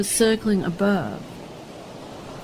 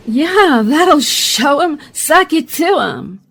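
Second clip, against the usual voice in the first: higher, louder, slow gloat; triumphant gloating; 5 semitones higher, 5 dB louder, slower than usual. Acted. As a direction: savour the enemy's defeat. Reporter gloats after the blast.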